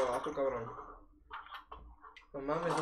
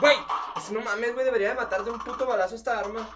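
frustrated rant; 7 semitones higher, 7 dB louder than usual. Real, not acted. Annoyed at weak weapon.

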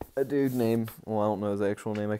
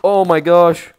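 loud startled cry; shocked yell; 8 semitones higher, 16 dB louder, slower than usual. Real, not acted. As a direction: blurt it out in shock. Sudden shock in game.

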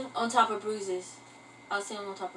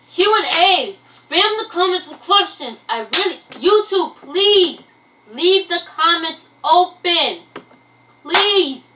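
raised, emphatic announcement with drawn-out stresses; loud pleading; 4 semitones higher, 6 dB louder, slower than usual. Real, not acted. Vlogger begging YouTube to leave comments open, loud pleading. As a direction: call it out like an urgent appeal.

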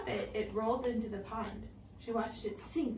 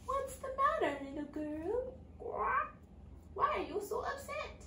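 much higher, tiny voice from the pond; a little frog calling up kindly; small frog voice; 13 semitones higher than usual. A narrator's reading. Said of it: "a small voice came from the pond".

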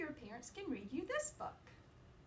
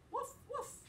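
high, bouncy woof woof; playful dog bark. Acted. Teacher barking for Biscuit to the kids, high and playful. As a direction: bark like a happy puppy.

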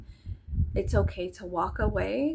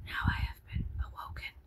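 mock dramatic whisper; 7 dB quieter than usual. Jokes after the sound.

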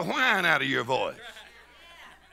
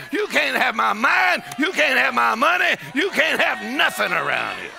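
high, forceful preaching declaration; lifted bold voice; 4 semitones higher than usual. Acted. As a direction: shout it defiantly at the devil. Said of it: "lift up your voice and say".

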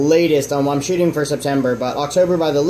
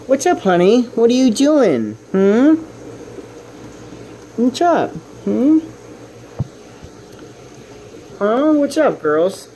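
higher sweet pet-talk voice, drawn out; cooing at pet fish; 8 semitones higher than usual. Real, not acted. Owner coaxing her hiding fish out, higher cooing pet voice. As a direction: coax a shy fish out of hiding.

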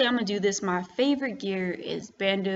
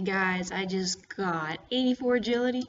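ordinary talk, nothing marked

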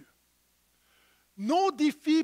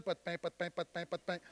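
mock mumbling; 5 semitones lower, 7 dB quieter, faster than usual. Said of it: "Jésus leur enseigne une vérité spirituelle".

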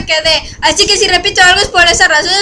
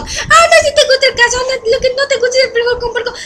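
higher, laughing mock mom; 6 semitones higher, 3 dB quieter than usual. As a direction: play mom insisting through laughter, pitched up. Mamá imitada, riendo.

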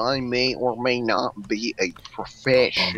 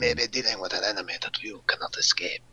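hushed fast whisper; 5 semitones lower, 6 dB quieter than usual. Acted. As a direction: whisper it like a secret briefing. Mission briefing whispered.

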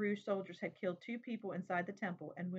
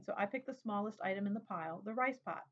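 plain reading voice

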